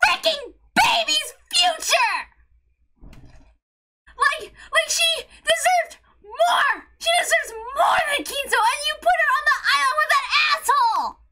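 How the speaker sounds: high, impassioned outcry; upset, angry rant. Real, not acted. Upset rant defending the character.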